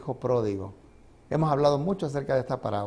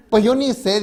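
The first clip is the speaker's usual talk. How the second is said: higher, louder imitation of a bewildered old woman; mock old lady; 7 semitones higher, 7 dB louder than usual. Preacher mimicking the old lady's clueless answer, higher and louder. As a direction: play her helpless shrug, pitched up and louder.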